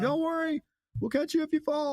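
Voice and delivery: high-pitched, lightly sung-out mock reassurance; circling helpers. Weasleys to Harry below the broom, high mock reassurance. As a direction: put on a high voice to reassure someone. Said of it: "they act like sharks".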